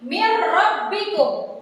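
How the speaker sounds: Mir rabbikum, the n merged into a doubled r, no nasal hum; slow, clear recitation without dengung; correct idgham bila gunah. Shown as a demonstration. Teacher recites Mir rabbikum for the class, slow and clear. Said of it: "Sekali lagi".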